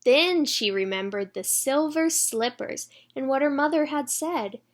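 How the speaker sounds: put-on bright read-aloud for children; storybook reading. A narrator's reading.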